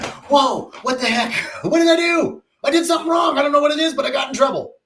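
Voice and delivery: put-on high, loud, bewildered kid cry; a small son hit without warning, asking what he did wrong; startled child. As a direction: yelp it like a confused little boy. Child startled by ear flick.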